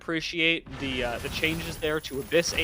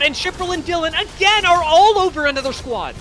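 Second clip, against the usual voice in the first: much higher and louder hype shout; excited call; 13 semitones higher, 8 dB louder than usual. Acted. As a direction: burst in as the fight erupts. Caster reacts to a new fight.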